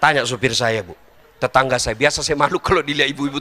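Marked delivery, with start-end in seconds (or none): none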